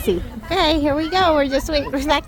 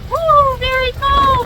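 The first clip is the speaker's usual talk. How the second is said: excited; 11 semitones higher, 4 dB louder, slower than usual. Real, not acted. Outside in the cold.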